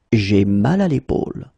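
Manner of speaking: ordinary delivery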